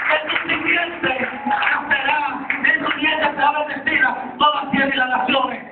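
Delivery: high, driving preacher's delivery; impassioned sermon storytelling; full-force preaching. Real, not acted.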